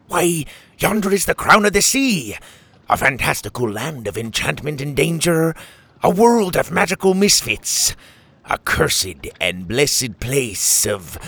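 grandiose pirate speech. A narrator's reading. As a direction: declaim it like an epic tale. Captain grandly describes Yondra.

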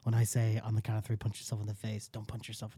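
whisper. Real, not acted. Secretly briefs Jackson on the prank.

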